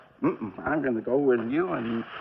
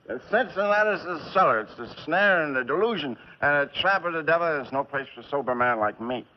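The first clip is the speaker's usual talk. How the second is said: ordinary talk, nothing marked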